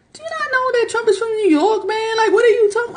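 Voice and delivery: high incredulous put-on voice; mock disbelief. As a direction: sound stunned he doesn't know. Retorts to man stereotyping Trump voters.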